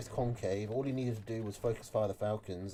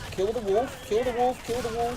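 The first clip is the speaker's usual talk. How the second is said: higher, louder urgent chant; excited urging; 10 semitones higher, 5 dB louder than usual. Real, not acted. Caster urging the player to kill the wolf, excited. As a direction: cheer on the kill in a rapid-fire burst. Casting a tense game moment.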